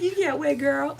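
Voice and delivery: words breaking into laughter; laughing. Real, not acted. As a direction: deliver the punch line while cracking up. Laughs at own punch line.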